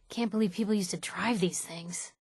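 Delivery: ordinary delivery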